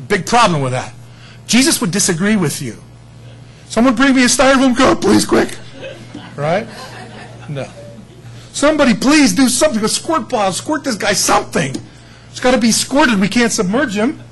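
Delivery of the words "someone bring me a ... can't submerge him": high, loud, frantic mock plea; mock panicked believer. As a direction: ham up a fussy panic over a cup of water. Mocking baptism-obsessed people panicking.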